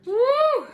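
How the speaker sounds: high, loud "woo"; long high whoop; onion-sting whoop. Real, not acted. Reacting to strong onion fumes.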